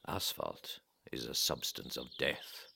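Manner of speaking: usual speaking voice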